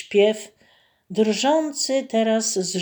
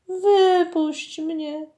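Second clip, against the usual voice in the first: high, slow drawn-out plea from the mermaid; a captured mermaid begging to be freed; 9 semitones higher, much slower than usual. A narrator's reading. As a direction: plead "Wypuść mnie" high and slowly, stretching "wypuść". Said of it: "błagało cudne zjawisko".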